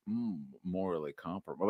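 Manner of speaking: low, very soft murmur; doubtful musing. Acted. Viewer suddenly doubting Professor X, low and hushed. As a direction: ponder it quietly, half to yourself. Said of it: "suddenly we're like".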